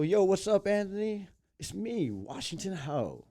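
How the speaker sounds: high-pitched, eager call to a celebrity; excited greeting. Retold or imitated. Greeting a celebrity at his wedding.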